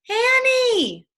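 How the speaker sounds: very high, loud alarmed shout. Acted. Jack hears Annie shriek and yells her name, very high and loud. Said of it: "he heard Annie shriek".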